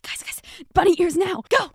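put-on loud, high urgent call to the other kids; excited shout. Kids urging friends to make faces, excited shout. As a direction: yell at your friends to go now. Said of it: "we were like".

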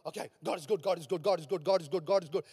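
low, soft, mumbled rote chant; parroting by rote. Acted. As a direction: repeat it mechanically, mock parrot fashion. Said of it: "like a parrot sitting and repeating".

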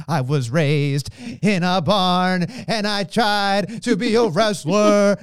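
slow, drawn-out wrestling promo impression; mock-dramatic, laboured delivery; Pat McAfee. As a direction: stretch out every word, imitating a wrestler's speech. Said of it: "the way he does it".

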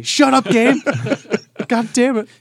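high, loud indignant yell; angry yell. Pretend. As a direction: yell back at the game, outraged. Gamer yelling back at app.

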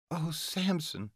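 high, slow, soft gasp of a name; breathy female voice. Acted. Heroine cries out at climax.

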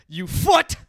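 hammed-up angry shout; mock yelling. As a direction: yell it like an absurd curse. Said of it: "he's just yelling body parts".

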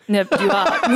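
retold line breaking into a laugh; laughing quote. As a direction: laugh through the teammate's words. Teammate's reaction, laugh heard.

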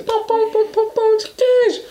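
very high mocking squeak; high squeaky mockery. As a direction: parrot the stammer in a squeaky voice.

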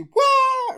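very high, loud fake crying wail; mock wail. Sister breaking down, hammed-up crying wail. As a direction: bawl like a baby for laughs. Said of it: "And then, like, she".

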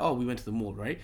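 usual speaking voice

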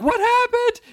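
very high, loud imitation of his wife; mock alarmed shriek. Imitating wife seeing husband fallen, alarmed shriek. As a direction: shriek it in exaggerated falsetto alarm.

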